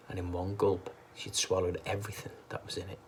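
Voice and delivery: ordinary delivery, nothing marked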